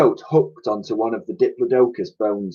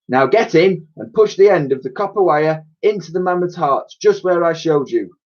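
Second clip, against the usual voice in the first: higher, demanding professor; 6 semitones higher than usual. Acted. Professor demands whether Elsie found the hatch. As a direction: press her for an answer.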